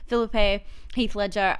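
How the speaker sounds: ordinary delivery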